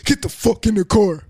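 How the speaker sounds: loud, high angry bark; mock yell at his girl; retold yell. Boyfriend barking at her to get in the car, loud.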